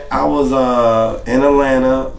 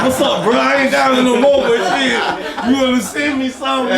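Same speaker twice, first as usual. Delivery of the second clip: laughing casual greeting; 9 semitones higher than usual. Retold or imitated. Answering supposed weed dealer's call.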